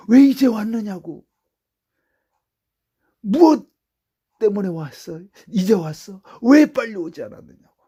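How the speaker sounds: loud, high preaching; full-force preaching. Acted. Preacher proclaiming to the congregation, loud and high.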